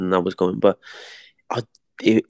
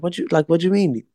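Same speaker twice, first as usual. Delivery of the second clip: high, fast, puzzled reply; his own retold question; 8 semitones higher, faster than usual. Boxer asking his mate what he means, high, fast and baffled. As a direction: ask it quick and baffled. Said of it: "And I said".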